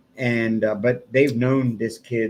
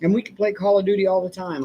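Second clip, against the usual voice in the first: lightly voiced pleading kid, higher; imitating a begging kid; 8 semitones higher than usual. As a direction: mimic the boy begging, a touch whiny and eager. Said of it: "he's begging me".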